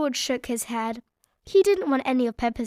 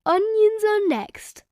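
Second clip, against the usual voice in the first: high slow prompt; parent reminding; 9 semitones higher, 4 dB louder, slower than usual. A narrator's reading. Parent telling children onions come next, high. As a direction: announce it helpfully. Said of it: "reading the list".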